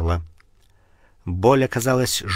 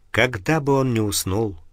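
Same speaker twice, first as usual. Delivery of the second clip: plain reading voice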